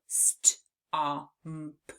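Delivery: "st, ah, m, p" sound by sound; careful phoneme segmenting; sounding out stomp. Shown as a demonstration. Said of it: "in the word stomp".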